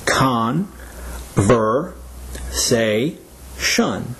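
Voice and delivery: "Conversation" broken into four separate beats; syllable by syllable. Shown as a demonstration. Teacher showing four syllables, each said on its own with pauses. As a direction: pause between "Con", "ver", "say" and "shun". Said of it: "Conversation has four syllables".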